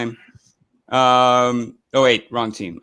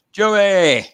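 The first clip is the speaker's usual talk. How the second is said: higher, loud drawn-out call of a name; 8 semitones higher, 6 dB louder than usual. Real, not acted. Host calling out to Joey in the community, hollered.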